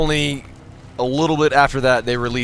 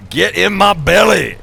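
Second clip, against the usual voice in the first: loud and higher, relishing the name; louder name call; 8 semitones higher, 8 dB louder than usual. Real, not acted. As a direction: belt the name out. Reading a funny sub name.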